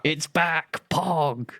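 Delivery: excited. Real, not acted. Cheering the puzzle's return.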